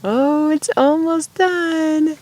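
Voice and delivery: drawn-out, wistful sunset murmur. Real, not acted. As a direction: let the words linger as the sun goes down. Watching the sunset.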